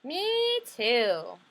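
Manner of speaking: lightly voiced little sister; a hint of a small girl chiming in; mild little sister voice. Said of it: "Guess what my little sister said".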